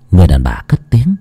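ordinary delivery, nothing marked

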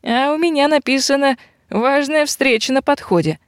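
high-pitched feminine character voice; eager fortune reading. A narrator's reading. Robber woman reading her fortune cookie, intrigued.

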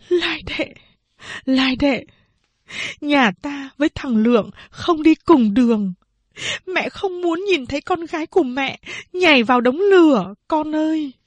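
high, tearful pleading; crying plea. A narrator's reading. Mother weeping over her eldest daughter, pleading tearfully. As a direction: beg her daughter through tears. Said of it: "ôm vai chị cả mà khóc".

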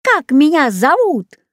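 high, challenging creature voice; monster bird's cry. A narrator's reading. Ugly flying beast quizzes the girl, crying out. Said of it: "закричала уродливая птица-зверь".

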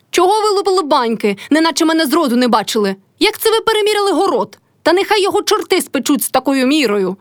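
high-pitched scolding, restrained in volume; indignant narrated outburst; furious yelling. A narrator's reading. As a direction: berate them in a storyteller's mild yell. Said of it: "гукнула не своїм голосом Мотря".

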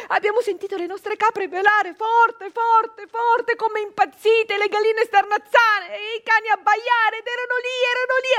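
high, loud, urgent telling; building alarm. A narrator's reading. Narrator reliving the uproar in the yard, loud and alarmed. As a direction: rush it as panic rises. Chaos in the farmyard.

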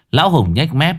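ordinary delivery

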